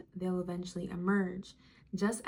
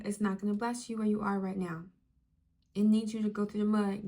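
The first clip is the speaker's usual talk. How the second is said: ordinary talk, nothing marked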